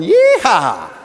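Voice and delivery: loud whooping yeehaw with a laugh; gleeful yeehaw. Retold or imitated. Mom alone in the empty bedroom, whooping with glee. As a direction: whoop like she just won the lottery. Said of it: "and she's going".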